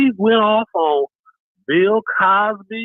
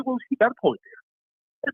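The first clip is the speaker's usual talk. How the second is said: British socialite; 6 semitones higher, 4 dB quieter, slower than usual. Retold or imitated. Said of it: "it was my British socialite".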